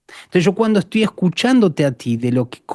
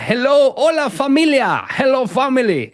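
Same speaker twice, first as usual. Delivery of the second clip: greeting the church; 5 semitones higher than usual. Retold or imitated. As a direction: call out warmly to the congregation. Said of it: "agarro el micrófono y digo".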